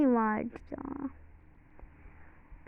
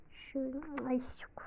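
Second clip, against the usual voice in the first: whisper; 14 dB quieter than usual. Real, not acted. Aside while filming.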